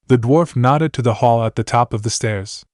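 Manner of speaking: ordinary delivery, nothing marked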